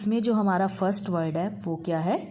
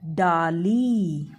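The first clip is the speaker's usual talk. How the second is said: "डाली" stretched very slowly and louder; very slow dictation; 3 dB louder, much slower than usual. Shown as a demonstration. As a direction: drag out "डाली" for the kids to repeat. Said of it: "क्या है ये?". ("डाली" बहुत धीरे, खींचकर)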